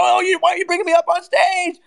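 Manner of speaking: high-pitched imitation of a complainer; mock complaint. Speaker mimicking complaints about being brought on stage, mock complaint. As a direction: whine it high, mocking the objection.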